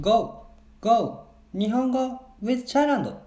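high, bright shouted show opener; excited intro call. Acted. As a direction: call out the show title with a big lift. Podcast opening jingle-style call. (番組タイトルを元気よく叫ぶ)

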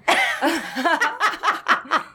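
high giggly burst of laughter; giggle fit. Real, not acted. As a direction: break into real, high giggles. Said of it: "Very giggly down there".

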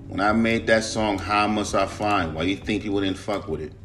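usual speaking voice

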